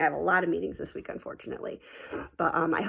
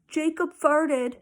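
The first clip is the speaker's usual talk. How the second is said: put-on tattling kid; 9 semitones higher, 5 dB louder, much slower than usual.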